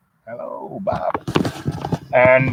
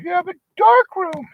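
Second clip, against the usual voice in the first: very high falsetto delight; high-pitched mock girlfriend gasping at the view; gushing girlfriend. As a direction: squeal it out much higher, amazed. Wife voiced gushing over apartment.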